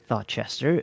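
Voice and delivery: ordinary delivery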